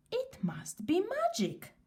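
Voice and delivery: crying out in wonder; delighted cry. A narrator's reading. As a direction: shoemaker marvelling at the new shoes, delighted. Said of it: "he cried".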